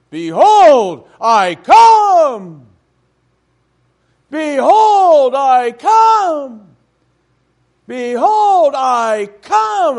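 loud, thundering pulpit proclamation, repeated; preacher shouting. Retold or imitated. Young preacher quoting Revelation from the pulpit, shouting. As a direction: bellow it like a fiery young preacher. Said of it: "he was quoting the scriptures".

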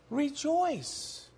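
soft, settled "Rejoice." falling; quiet affirmation. Real, not acted. Preacher quietly affirming the apostle's command, soft and sure. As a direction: drop "Rejoice." to a calm, soft certainty. Restating what scripture said.

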